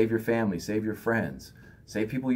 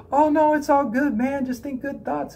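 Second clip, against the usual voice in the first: much higher, breezy mock brush-off; fake reassurance; 4 dB louder than usual. Brushing off a venting friend, high fake cheerful voice. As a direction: toss off hollow cheer in a high voice. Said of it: "give them some fake stuff and be like".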